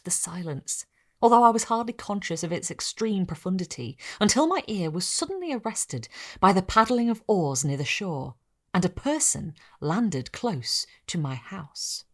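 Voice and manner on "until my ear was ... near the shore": ordinary delivery, nothing marked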